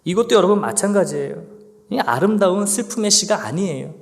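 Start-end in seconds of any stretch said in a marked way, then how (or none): none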